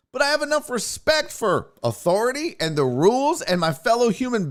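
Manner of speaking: high, heated lecture; imagined rant. Pretend. Comic imagining telling off a maskless man, heated rant. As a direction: scold him like a fed-up traveller.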